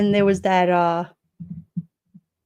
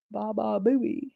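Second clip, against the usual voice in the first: soft repeat; 6 dB quieter, slower than usual. Real, not acted. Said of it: "I couldn't think of his name".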